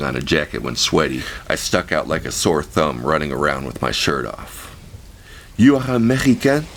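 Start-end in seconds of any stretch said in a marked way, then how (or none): 5.6-6.7 put-on high, louder curious question in French-Vietnamese accent. A narrator's reading. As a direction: ask him in her French-Vietnamese accent. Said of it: "Her accent was a strange mixture of French and".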